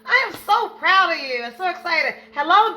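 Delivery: high, loud, thrilled hello; excited greeting. Real, not acted. Host greeting arriving viewers, thrilled and loud. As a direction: greet her with delight.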